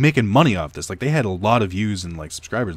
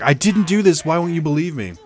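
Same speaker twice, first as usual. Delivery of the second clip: put-on crying kid pleading; 7 semitones higher, 4 dB louder than usual. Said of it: "as he's, like, crying on the bed".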